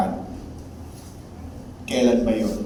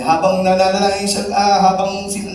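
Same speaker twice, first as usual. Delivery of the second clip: ordinary talk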